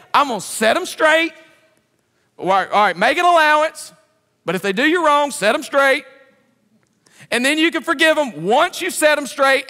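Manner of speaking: lightly voiced pretend scripture, loud; mock addendum to God. Preacher pretending to add to God's word, mock loud. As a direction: announce a made-up verse with a grin. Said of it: "I wish we could add addendums to God's word".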